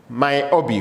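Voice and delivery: usual speaking voice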